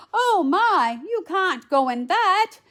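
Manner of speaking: high, loud fairy godmother exclamation; crying out. A narrator's reading. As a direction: exclaim it in storybook dismay. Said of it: "cried the fairy godmother".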